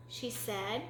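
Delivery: usual speaking voice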